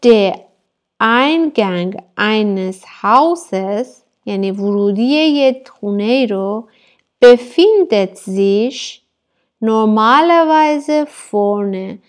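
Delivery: each word stretched out slowly for learners; spelled-out slow classroom reading; slow dictation reading. Shown as a demonstration. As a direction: read it very slowly so students can follow. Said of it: "teacher reads German slowly".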